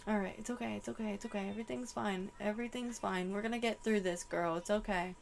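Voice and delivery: soft, hushed comforting repeats; calm her down gently under your breath; soft reassurance. Real, not acted. Soothing herself and Celeste.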